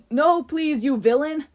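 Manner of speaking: loud, high melodramatic line; called out. A narrator's reading. A boy feeding Hazel her line, loud call. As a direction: call out like a hammy actress. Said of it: "one of the boys called out".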